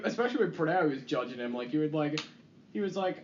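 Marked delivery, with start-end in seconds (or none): none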